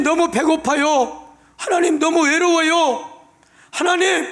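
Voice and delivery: high, slow, crying plea to God; pleading prayer. Retold or imitated. A pastor retells his desperate prayer, pleading and high.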